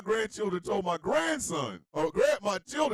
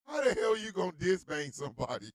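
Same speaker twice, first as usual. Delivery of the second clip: ordinary delivery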